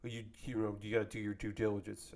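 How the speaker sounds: low, muttered imagined reply; quietly chide, as an imagined critic; soft, low pretend scolding; mock chiding. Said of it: "they would be like".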